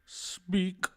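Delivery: cold otherworldly one-word order; eerie sage voice. Acted. Sage voice, short command.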